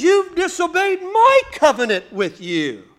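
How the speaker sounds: high, loud, accusing cry; divine rebuke shout. Acted. Preacher shouting God's charge at disobedient people. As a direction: thunder it as the Lord rebuking.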